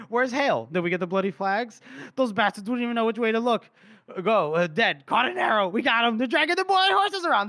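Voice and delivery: high, loud mock banter of victorious soldiers; rowdy soldiers. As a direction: hype it up like soldiers after a raid. Voicing celebrating Northern soldiers.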